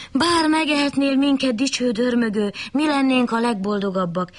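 high, honeyed flattery; butter up the bear sweetly; flattering wolf. A narrator's reading. Wolf flatters the bear to save its skin, fawning. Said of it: "hízelkedett a farkas".

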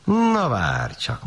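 slow, drawn-out storybook menace; slowly muttered mock threat; grumbled threat. A narrator's reading. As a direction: grumble a warning, stretching the first word.

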